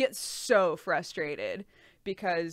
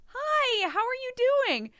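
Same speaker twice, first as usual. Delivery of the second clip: much higher, bright mock-cheery greeting; cheery doctor; 4 dB louder than usual. Imitating the doctor's cheery hello to a pregnant patient. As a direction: chirp it like a sunny doctor. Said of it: "and she goes".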